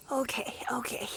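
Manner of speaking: relieved whisper. Acted. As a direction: breathe it out in relief.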